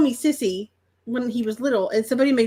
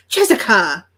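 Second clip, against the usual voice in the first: louder, lower full-name telling-off; mock scolding; 4 semitones lower, 5 dB louder, slower than usual. To a friend who has done something crazy, mock scolding. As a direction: call out the name like a reprimand. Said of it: "like, you've done something crazy".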